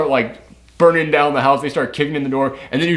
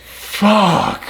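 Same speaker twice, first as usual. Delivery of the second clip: higher, shocked outburst; stunned curse; 7 semitones higher than usual. Real, not acted. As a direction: blurt it out in disbelief. Reacting to the massacre.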